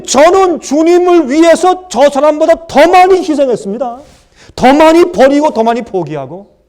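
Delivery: loud, high, whining demand to God; entitled complaint. Acted. Preacher voicing a believer complaining to God, loud and whining.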